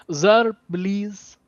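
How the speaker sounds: high, halting mock Indian accent; Osho. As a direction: plead in a high Indian accent, pausing between phrases. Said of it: "my inspiration is Osho".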